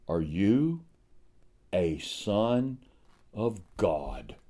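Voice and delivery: a soft, low, near-whispered "Are you a son of God?"; hushed question. Acted. Preacher quietly asks each listener, hushed and searching. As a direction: lean in and ask it gently, almost under the breath.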